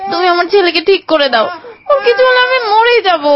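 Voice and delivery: tearful plea rising to a high cry; distraught mother crying out; desperate pleading. Acted. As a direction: beg your husband, close to breaking. Frantic mother pleads with husband.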